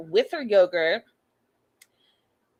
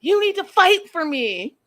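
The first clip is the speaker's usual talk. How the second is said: mock demand; 8 semitones higher, 5 dB louder than usual. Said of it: "After three days".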